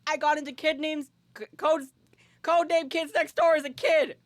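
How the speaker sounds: high, impassioned fan rant; theatrical outrage. Real, not acted. Fan outraged that mod was removed.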